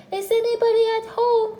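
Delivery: loud call pitched right up high; high, forceful holler. A narrator's reading. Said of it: "called out Pooh very loudly".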